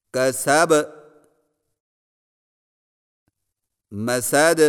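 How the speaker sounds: each word ending crisp, with a bouncing echo on its final letter; careful recitation. Shown as a demonstration. Teacher demonstrating precise Quranic pronunciation word by word. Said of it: "صحیح تلفظ کے ساتھ ادا کیجیے".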